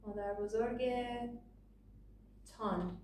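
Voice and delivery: tan said with a clear ah vowel; formal Persian tan; written form tan. Shown as a demonstration. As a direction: separate the tan ending after a pause. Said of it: "just like we did here, and say".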